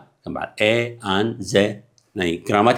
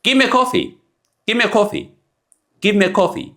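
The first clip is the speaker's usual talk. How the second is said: much higher, "a" reduced to a quick uh; high demo line; 11 semitones higher than usual. Shown as a demonstration. Teacher playing a customer ordering coffee. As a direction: lift it up and slide past the "a". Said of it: "teaching demo, high pitched".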